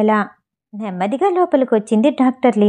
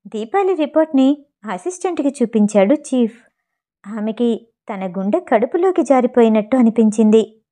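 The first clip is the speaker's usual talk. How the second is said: ordinary delivery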